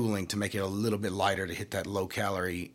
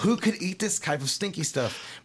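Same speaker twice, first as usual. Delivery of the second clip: disgusted mock reaction; 9 semitones higher than usual. A Japanese person tasting blue cheese, mock disgust. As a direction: recoil from the stinky cheese, a little hammed. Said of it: "they're gonna be like".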